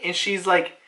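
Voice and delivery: usual speaking voice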